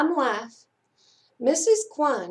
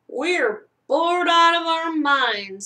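bored complaint; 6 semitones higher than usual. A narrator's reading. Conrad complaining to the cat, bored. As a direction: stretch out the boredom.

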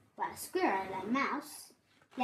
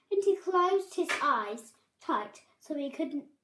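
ordinary delivery

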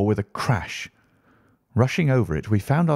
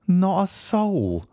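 slow, much higher groan of despair; 10 semitones higher, much slower than usual. A narrator's reading. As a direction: drag it out like a defeated man. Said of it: "groaned Hall Pycroft".

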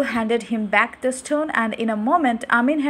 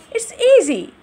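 boastful; 10 semitones higher, 6 dB louder than usual. Acted. Amin boasting.

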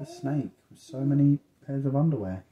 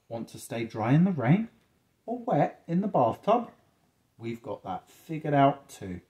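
animated storybook reading with stretched words and pauses; reading to children; 3 semitones higher, 6 dB louder than usual. A narrator's reading. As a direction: draw out the contrasts for a child. Reads picture book to child.

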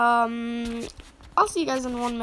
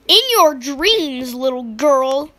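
loud, slow mocking taunt; mock sibling taunt; 10 dB louder, slower than usual. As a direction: taunt her sister loudly. Sister taunts sister.

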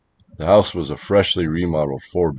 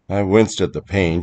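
ordinary delivery, nothing marked

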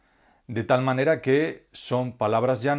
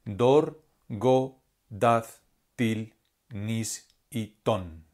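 each stressed syllable "dor", "go", "daz", "til", "nis", "ton" said on its own and spaced out, the last three slowly; stressed syllables alone; much slower than usual. Shown as a demonstration. Teacher isolating stressed syllables for the class, spaced and slow. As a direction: lift out "dor" through "ton" one by one like a teacher. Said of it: "con mayor intensidad o con mayor fuerza".

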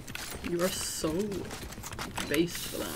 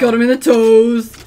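excited shout; 4 semitones higher, 14 dB louder than usual. Real, not acted. Celebrating a hit.

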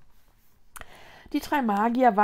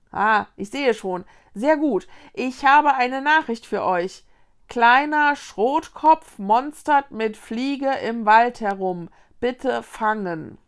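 loud, deliberate announcement; slow, emphatic relay of a garbled message; officious fir messenger; 2 semitones higher, 8 dB louder than usual. A narrator's reading. Fir tree delivers a message. (laut und bedächtig verkündend)

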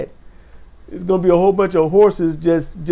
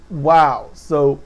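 ordinary talk, nothing marked

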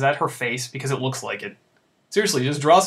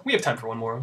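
"We have time for one more." settled, lower and softer, falling on "more"; answering; 4 semitones lower, 4 dB quieter, faster than usual. Real, not acted. Streamer answering his own question, settled. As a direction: confirm it to yourself, calm.

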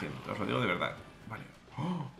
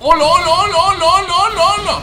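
very high, loud rapid-fire repeats; sustained high-pitched excited yelling; frantic shouting; 13 semitones higher, 12 dB louder than usual. Real, not acted. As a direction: yell it over and over in excitement. Frantic reaction in game.

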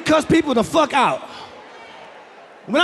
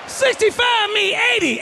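rage yell; 10 semitones higher than usual. Acted. Comedian yelling road rage rule.

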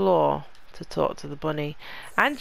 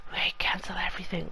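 whisper; 8 dB quieter, faster than usual. Real, not acted. Whispered aside after a sigh.

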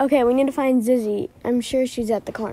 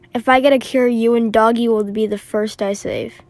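usual speaking voice

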